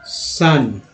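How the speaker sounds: ordinary delivery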